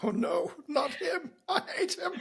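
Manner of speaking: high, soft laughing mock dread; mock dismay. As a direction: play along with joking horror, pitch climbing. Co-host plays along with the sarcasm.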